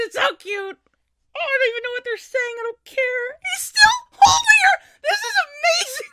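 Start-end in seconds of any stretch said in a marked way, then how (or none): 3.5-6.1 high, loud squealing delight; excited squeal. Real, not acted. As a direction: shriek with joy. Fan squealing at anime scene.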